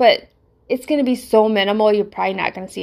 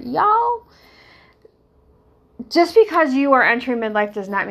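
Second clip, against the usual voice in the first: put-on Southern accent; 3 semitones higher than usual. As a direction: call out y'all Southern style. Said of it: "Hitting my southern family here".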